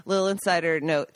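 normal talking voice

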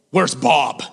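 a blunt low grunt of a question; curt old pastor. Retold or imitated. Joel ignores new intern, asking low and curt.

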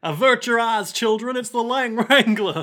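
mock alarmed warning.

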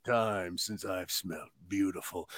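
deep, hushed movie-star impression; Vin Diesel. Host creeps up behind partner cooking, deep hushed voice. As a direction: sink the pitch way down, keep it soft. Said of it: "that deep Vin Diesel voice".

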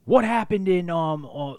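imitated scolding yell; mock screaming. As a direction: launch into a retold teacher's interrogation. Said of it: "screaming at him".

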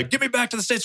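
high urgent demand; frantic call for help, voice way up; hammed-up frantic plea; mock panic.

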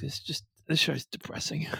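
whispered, defeated aside. Real, not acted. Fed up after ranting about the show, whispering. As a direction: breathe it out, worn down.

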